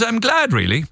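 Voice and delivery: put-on a light stab at a Manchester accent; lightly mimicked Manchester fan. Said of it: "he said it in a Manchester accent".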